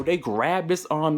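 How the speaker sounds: ordinary delivery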